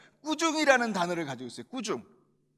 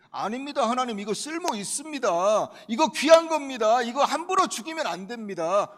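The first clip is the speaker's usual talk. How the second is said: insistent protest; 5 semitones higher, 6 dB louder than usual. Acted. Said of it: "이렇게 해버린 거예요".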